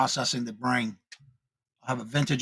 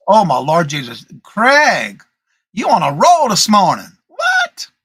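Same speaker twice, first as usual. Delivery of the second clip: loud, high-swooping outburst; amused exclamation; 3 semitones higher, 11 dB louder, slower than usual. Real, not acted. Host teasing Craig for being on a roll, loudly exclaiming. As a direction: cry out in playful disbelief. Reacts to viewer's witty comment.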